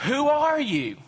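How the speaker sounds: pitched well up, pointed ask; demanding question. Acted. Priests from Jerusalem questioning John, pointed high demand. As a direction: press him hard, who are you.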